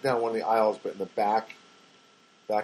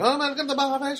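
put-on much higher, cute little-kid voice; mumbling young girl; 14 semitones higher than usual. Phone order taker greeting the caller, high little-kid mumble. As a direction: pitch it way up like a shy young child. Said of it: "So she's, like, mumbling".

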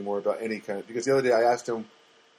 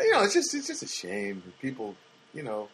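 ordinary talk

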